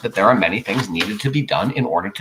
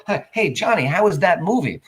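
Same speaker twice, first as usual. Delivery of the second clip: cheery greeting; 7 semitones higher than usual. Retold or imitated.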